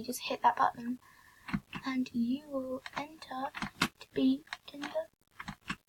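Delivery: hushed whisper aside. Real, not acted. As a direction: whisper it quickly under your breath. Aside while recording.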